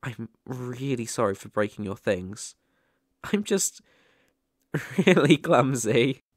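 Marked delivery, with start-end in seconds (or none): 3.3-6.2 embarrassed laugh. Acted. Ghost apologizing for breaking things.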